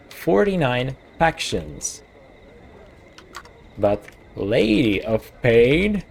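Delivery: slowly spelling it out while writing it down; drawn-out words, like taking notes aloud; speaking slowly as he writes notes; slow dictation. Real, not acted. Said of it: "I gotta write this shit down".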